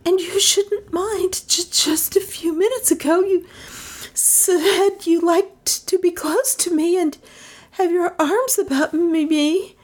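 high, halting, stammering plea; whimpering. A narrator's reading. Melissa begging Trent to hold her, high whimper. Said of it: "she whimpered".

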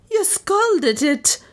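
slow, very high wail; wild cry. A narrator's reading. Maid blaming mistress for scalded foot, wild cry. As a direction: cry out wildly, stretching it high. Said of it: "cried Lizzie wildly".

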